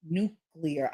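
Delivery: "Nuclear" said carefully as noo-klee-er; correct nuclear. Shown as a demonstration. Correcting herself after saying nuclear wrong, careful pronunciation. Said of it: "don't know how to pronounce nuclear".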